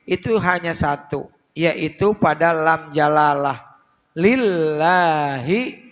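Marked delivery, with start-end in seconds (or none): 4.1-5.8 Lillahi with the lam held long and doubled. Shown as a demonstration. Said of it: "lamnya ada tasdid". (lam ditekan panjang pada "Lillahi")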